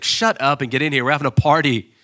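father welcoming the prodigal son home to a party; joyful father. Retold or imitated. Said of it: "He's like".

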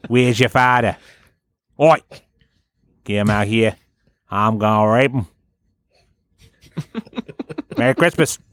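lightly put-on Australian tough guy; hammed-up Aussie brawler calling out; mock Aussie kangaroo. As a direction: swagger in like a rowdy Aussie. Acting the Christmas kangaroo.

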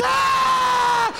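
long, high mock scream; sustained high wail; labor scream. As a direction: shriek with labor pain. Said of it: "I hear her scream".

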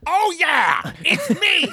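Gilbert Gottfried impression. Doing Duff Man but comes out as Gilbert Gottfried. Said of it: "that Gilbert Godfrey is so good".

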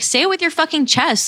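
high-pitched retold call-out; confrontational challenge. Girl calling out the guy muttering about her, confrontational. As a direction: dare him, pitch well up, no shouting.

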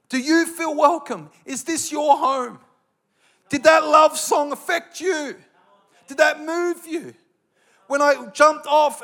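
loud, slow, pleading question; preacher shouting at the congregation. Real, not acted. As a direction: call out to the whole room.

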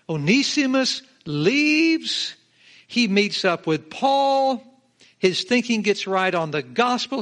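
slow, loud and high, each word hammered; preaching at full force. Real, not acted. Pastor retelling Onesimus to the church, loud slow preaching. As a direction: call out the story like a revival preacher.

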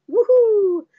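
high, loud whoop; mock cheer. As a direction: whoop with playful glee. Mock cheer at radio career prospect.

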